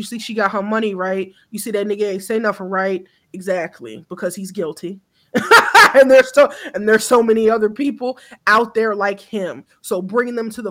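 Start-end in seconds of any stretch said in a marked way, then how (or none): 5.3-5.9 short, high, loud laugh; a sudden bright laugh at your own line; burst of laughter. Real, not acted.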